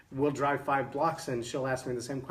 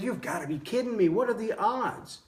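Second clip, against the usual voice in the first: can't believe the coincidence; amazed disbelief; 7 semitones higher than usual. Retold or imitated.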